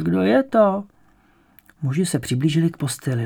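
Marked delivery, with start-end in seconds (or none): 0.0-0.8 put-on high, slow old woman; old woman's voice. A narrator's reading. Old woman in bed asking after granddaughter, high slow voice. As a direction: ask slowly in an old woman's higher voice. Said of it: "opakoval ženský hlas".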